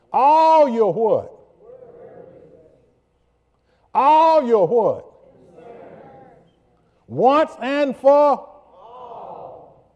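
high, loud, slow shouted preaching; full-force preaching. Acted. Pastor to congregation, shouting the verse. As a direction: roar it to the rafters.

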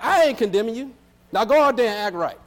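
mock casual Jesus talking like young people; slangy Jesus. Pastor voicing Jesus for the young folks, lightly slangy. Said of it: "forgive me for my vernacular".

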